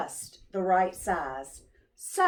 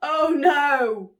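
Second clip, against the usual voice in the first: mock dismay; 4 semitones higher, 6 dB louder than usual. A narrator's reading. Narrator exclaims at snowfall.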